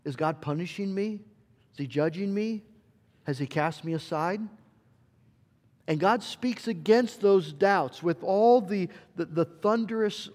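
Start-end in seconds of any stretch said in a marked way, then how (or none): none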